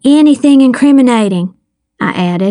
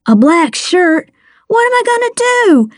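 higher, rushing panic; frantic teen boy, much higher and fast; panicked; 9 semitones higher than usual. Acted. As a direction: spiral into frantic worry, fast. Boy panicking over suspect description.

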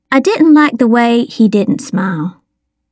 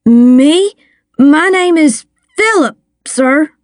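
put-on higher, halting nervous boy; frightened; 6 semitones higher, slower than usual. A narrator's reading. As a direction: stall nervously, pitched up, faltering before the name. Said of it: "Dale blanched".

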